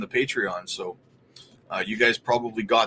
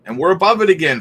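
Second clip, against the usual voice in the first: excited; 4 semitones higher, 8 dB louder, faster than usual. Real, not acted. Price breaks above average.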